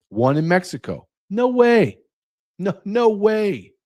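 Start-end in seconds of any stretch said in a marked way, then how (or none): 1.3-3.7 high, drawn-out refusal; flat-out incredulous, way up high; emphatic disbelief. Real, not acted. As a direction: refuse to believe it, pitch soaring. Rejects the election result in disbelief.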